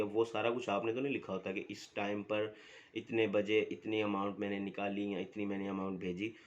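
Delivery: ordinary delivery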